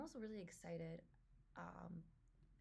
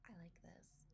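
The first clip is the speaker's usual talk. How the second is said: whisper; 9 dB quieter than usual. Real, not acted. Awed at trailer visuals.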